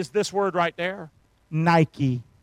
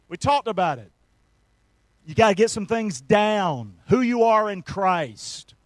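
ordinary delivery

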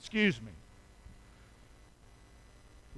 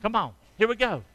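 usual speaking voice

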